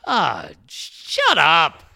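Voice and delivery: drawn-out, fed-up shut up; slow dismissive retort. Pretend. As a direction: drag it out in disgust. Retort to inspirational post.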